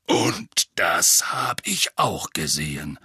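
low, slow, rough retort; sour Moody. A narrator's reading. Moody catching the witch's eye-roll, sour growl. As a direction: add it sourly, word by word. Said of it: "setzte er säuerlich hinzu".

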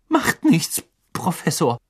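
high strangled voice; choked. A narrator's reading. Hermine answers the professor who clutched her neck, choking. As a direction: squeeze it out through a gripped throat. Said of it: "würgte Hermine und rieb sich die Kehle".

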